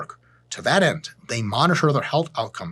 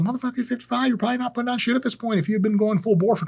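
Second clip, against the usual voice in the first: much higher, fast, incredulous; exasperated scolding; 10 semitones higher, faster than usual. Acted. Vlogger tells off a fifty-five-year-old cycler, exasperated scolding. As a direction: tell off an old gym rat.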